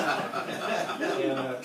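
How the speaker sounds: loud laugh spilling into the words. Real, not acted. Preacher laughing at Ray's surprising text, laughing. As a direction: laugh through the lead-in.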